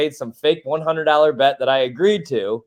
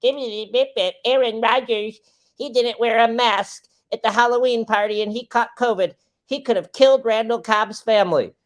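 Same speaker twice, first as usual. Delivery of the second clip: higher, far-off mock voice of Pete; lightly voiced whiny co-host; imitating Pete; 7 semitones higher than usual. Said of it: "when you have Pete on and I hear".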